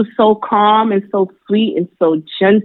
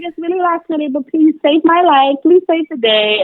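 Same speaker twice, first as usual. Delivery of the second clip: pleading client; 10 semitones higher than usual. Retold or imitated. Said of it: "And she was like".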